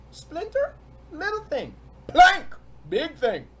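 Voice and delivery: "Splinter, little thing" high, "Plank, big thing" loud and slow; splinter small, plank big. Shown as a demonstration. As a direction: make the plank sound huge. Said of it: "I wanna emphasize the difference".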